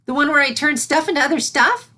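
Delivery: a small filly trying to say a big word; eager filly voice. A narrator's reading. Filly asking Celestia about the next lesson, eager.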